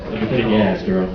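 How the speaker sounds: very deep, soft seductive voice; low purring come-on; sex voice.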